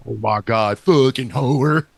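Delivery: slow, drawn-out country voice; deep country accent. Acted. Friend trying out the deep country neighbor, slow country accent. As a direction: stretch it in a lightly imitated country drawl. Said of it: "deep country".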